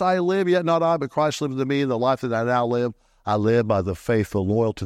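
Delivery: usual speaking voice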